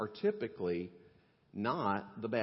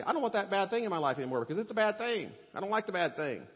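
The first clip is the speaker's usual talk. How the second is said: higher, louder and faster, a hammed-up resolve; mock everyman voice; 9 semitones higher, 4 dB louder, faster than usual. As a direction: play the average person swearing off sin, a bit cartoonish. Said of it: "they say".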